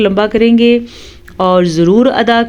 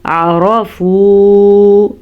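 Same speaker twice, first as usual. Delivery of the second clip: slow, drawn-out tajweed chant; Quran recitation; 3 dB louder, much slower than usual. Shown as a demonstration. Teacher reciting for students. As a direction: intone the Arabic slowly.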